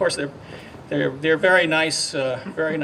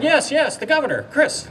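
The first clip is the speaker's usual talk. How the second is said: much higher, louder eager confirmation; excited governor; 11 semitones higher, 3 dB louder than usual. Retold or imitated. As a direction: imitate him bursting with enthusiasm. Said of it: "as you hear his excitability".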